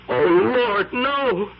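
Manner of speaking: high, panicked exclamation; man horrified by the empty photograph; horrified cry. Acted. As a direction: recoil in horror at what you see. Man sees the blank picture.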